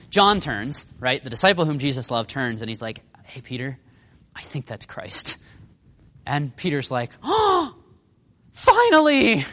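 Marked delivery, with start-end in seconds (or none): 3.2-5.4 soft, slowing down in awe; lightly acted quiet tip to a friend; hushed realization. John turning to Peter, hushed realization. 7.2-9.5 high gasp into a loud cry of relief; hammed-up overjoyed outburst; very excited. Peter told it is Christ, acting very excited. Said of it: "He's so excited".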